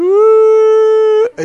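loud, very high crying wail; hammed-up child bursting into tears; child's wail. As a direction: let out a lost toddler's wail. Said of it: "they start to cry ... they just go".

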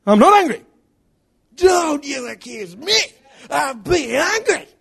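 high, loud, hammed-up shouting; furious denial. Husband denying his anger to his wife, shouting furiously. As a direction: roar the denial, dragging out the last words.

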